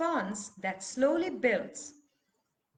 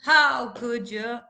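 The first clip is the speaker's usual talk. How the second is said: loud, slow, drawn-out "how"; indignant outcry; 7 dB louder, slower than usual. Shown as a demonstration. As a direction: wail the accusation out. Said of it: "angry and indignant".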